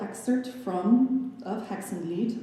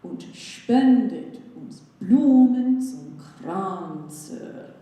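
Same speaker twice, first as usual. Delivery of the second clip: "Und spendet uns Blumen zum Kranze" very slow, middle syllable of each group stressed; amphibrachic stress shown; 3 semitones higher, 6 dB louder, much slower than usual. Shown as a demonstration. Lecturer demonstrates amphibrachic trimeter to the audience, slow and stressed. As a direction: sing out the da-DUM-da lilt of "spendet" and "Blumen". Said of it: "unstressed, stressed, unstressed syllables".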